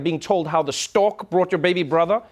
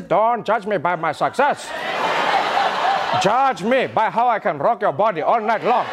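Nelson Mandela impression. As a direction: draw it out in a mock-seductive South African elder's accent. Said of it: "like, the Mandela accent?".

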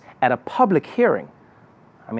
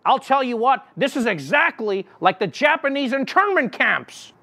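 pundit voice; 7 semitones higher than usual. Retold or imitated. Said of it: "some pundit was like".

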